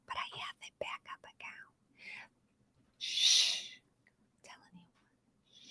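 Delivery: hushed, confiding whisper; secretive whisper. Pretend. Host confiding a backup account to viewers, secretive whisper.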